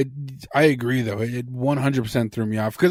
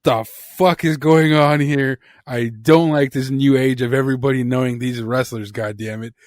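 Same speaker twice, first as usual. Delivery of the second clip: mock outrage; 6 dB louder than usual. A fan recalls pausing the video and ranting, loud mock outrage. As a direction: rant like a grumpy old fan betrayed. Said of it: "and I was like".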